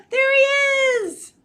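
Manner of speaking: high, loud cheer; squealed, thrilled exclamation; excited. Pretend. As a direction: burst out with delight for a story hero. Cheering Owl's graduation.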